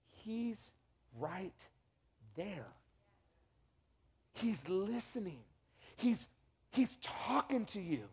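soft, slow and gentle; tender reassurance. Real, not acted. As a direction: reassure the listener quietly.

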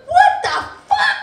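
put-on very high, loud kid yelling; child's shriek. Son screaming from the living room at his sister. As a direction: shriek it like an outraged little boy.

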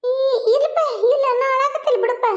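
high, put-on weepy voice; fake tearful denial. Mother telling her son she isn't crying, fake tearful denial. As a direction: deny it through pretend tears. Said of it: "ಯವ್ವ ಯಾಕ್ ಅಳಾಕತ್ತೀವಿ".

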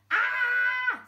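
long high loud scream; terrified scream. Acted. Passengers somersaulting in the Mini, screaming. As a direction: scream as the car flips. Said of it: "screamed everyone".

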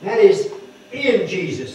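ordinary talk

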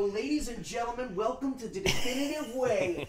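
grand showman announcement; announcer voice. Pretend. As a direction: present it like a ringmaster. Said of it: "announcer intro".

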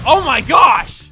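slow, loud, high exclamation; a jolt of alarm, shouted out; startled shout. Real, not acted. Gamer nearly hit by a bouncing player, startled shout. Near miss hits house.

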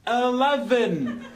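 very slow, stretched-out word; drawn-out. Acted. Man repeating floor to a deaf lift, drawn-out. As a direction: spell it out slowly for a machine.

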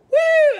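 loud high whoop; excited woo; cheer. Real, not acted. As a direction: whoop with joy. Cheer after singing.

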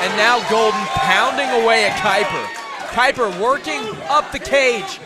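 excited play-by-play. Real, not acted. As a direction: call the finish with rising energy. Play-by-play of the finishing ground strikes.

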